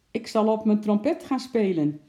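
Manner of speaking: lightly projected announcement; calling out. A narrator's reading. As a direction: call it up to someone overhead. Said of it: "riep de clown".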